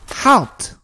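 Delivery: very high creature's cry; high-pitched voice. A narrator's reading. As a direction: call a sudden high challenge from above. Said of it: "high-pitched voice from above us".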